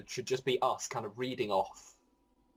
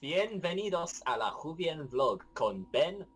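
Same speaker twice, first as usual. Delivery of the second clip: Spanish; 3 semitones higher than usual. Shown as a demonstration. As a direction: say it like a Spanish host. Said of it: "in loads of different languages".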